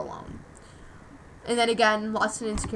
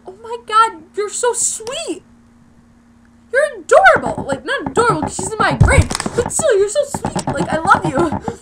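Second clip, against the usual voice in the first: much higher and louder, squealing with delight; gushing; 10 semitones higher, 8 dB louder than usual. Retold or imitated. Girl retelling what she told a shy classmate, gushing. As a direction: gush like she's the cutest thing.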